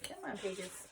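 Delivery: soft annoyed mutter. Real, not acted. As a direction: grumble at the pages. Mutter at sticky book.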